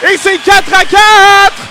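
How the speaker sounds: loud, high, stretched-out goal shout; excited sports commentary. Real, not acted. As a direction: scream the goal call to the crowd. Goal call in play-by-play. (commentaire de but survolté)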